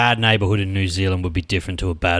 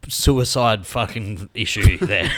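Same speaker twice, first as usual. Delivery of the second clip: usual speaking voice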